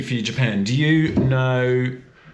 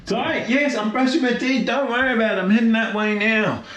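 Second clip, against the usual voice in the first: calling out; 11 semitones higher, faster than usual. Real, not acted. Answering an off-screen partner.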